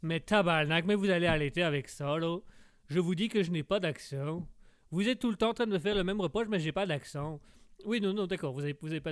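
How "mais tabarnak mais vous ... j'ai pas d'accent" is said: put-on exasperated Québécois accent. Québécois guest rejects the same reproach, exasperated Québécois accent. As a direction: complain in a broad Québécois accent. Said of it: "c'est le accent".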